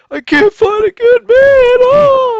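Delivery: very high, loud hammed-up wail; mock crying. Women on a talk show lamenting, mock wailing. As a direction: bawl it in a squeaky fake sob. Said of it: "They're all crying like".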